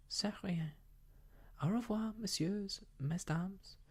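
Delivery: lilting French farewell with high pitch; sing-song voice. Acted. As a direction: sing the goodbye up and down. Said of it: "she said in a sing-song voice".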